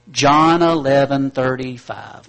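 "John eleven thirty-five" spoken slowly and loudly, word by word; slow, loud recital. Shown as a demonstration. Preacher drilling the congregation on the address, slow and loud. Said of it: "memorize the address for me".

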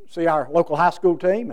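usual speaking voice

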